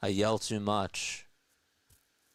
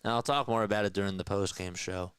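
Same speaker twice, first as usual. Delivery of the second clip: normal talking voice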